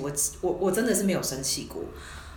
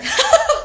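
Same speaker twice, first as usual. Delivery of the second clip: a burst of very high, loud laughter; embarrassed, delighted laugh; 9 dB louder than usual. Real, not acted. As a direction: shriek with laughter at the tease. Friend teased about lovestruck face.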